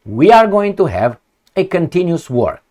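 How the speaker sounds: ordinary talk, nothing marked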